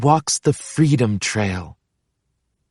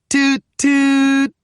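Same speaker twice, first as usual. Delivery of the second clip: much higher, sing-song train toot; playful toot toot; 12 semitones higher than usual. Pretend. Pete at the front of the cable car, playful high toot. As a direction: play conductor at the front.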